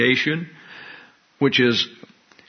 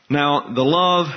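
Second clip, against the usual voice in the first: normal talking voice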